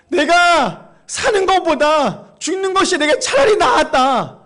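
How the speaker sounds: loud, drawn-out cry of complaint; bitter lament. Acted. Preacher voices Jonah complaining to God, bitter lament.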